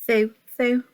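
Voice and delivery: plain reading voice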